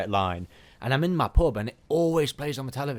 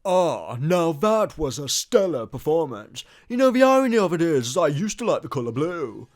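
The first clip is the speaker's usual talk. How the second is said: mock villain voice; 6 semitones higher, 7 dB louder than usual. Voicing a game character.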